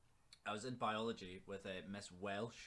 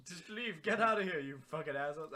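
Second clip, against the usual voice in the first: higher, louder mock telling off; mock angry shout; 7 semitones higher, 7 dB louder than usual. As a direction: snap at them to get out. Acted teacher telling them off.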